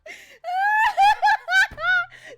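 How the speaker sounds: loud, high, long shrieking laugh; helpless laughter. Real, not acted. Streamer killed by a friend's joke, helpless laughter. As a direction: let out a long, piercing laugh.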